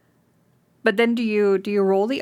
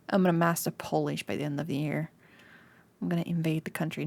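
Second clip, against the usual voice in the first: quiet; 4 semitones lower, 7 dB quieter, faster than usual. Real, not acted. Mock villain plan.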